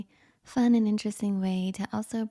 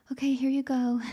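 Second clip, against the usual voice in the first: lightly acted gift-giver, slightly higher pitch; 5 semitones higher, 3 dB louder, faster than usual. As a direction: playfully hand over a present. Voicing handing over a gift.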